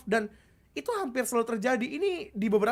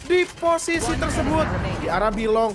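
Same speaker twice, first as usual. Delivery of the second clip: fast and loud esports call; fighting game announcer; 3 semitones higher, 6 dB louder than usual. Real, not acted. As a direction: push the tempo with the action. Fast hyped play-by-play.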